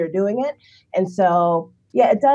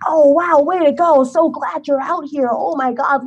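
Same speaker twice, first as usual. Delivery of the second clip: sugary higher cheerleader voice; mock gushing praise; 7 semitones higher than usual. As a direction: gush like a patronizing fan. Strangers cheering her run.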